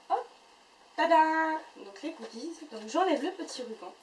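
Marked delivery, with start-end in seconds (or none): 0.1-1.5 bright, loud little tada; tada reveal. Real, not acted. Revealing the goodies to her viewers, a loud cheerful tada. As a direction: pop it out like a magic trick reveal. Unveiling the box contents.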